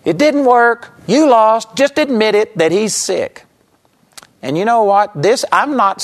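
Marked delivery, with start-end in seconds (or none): 0.0-3.4 high, loud, mocking gloat; sarcastic mother. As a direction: rub it in like a taunting mother. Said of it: "in a sense, says".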